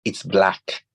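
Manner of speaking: lightly voiced American child echoing; a hint of a small American boy repeating; American five-year-old accent. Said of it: "in his American five-year-old accent".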